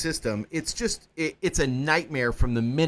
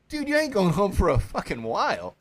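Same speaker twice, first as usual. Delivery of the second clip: put-on blunt old-timer; 5 semitones higher than usual. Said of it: "they're like".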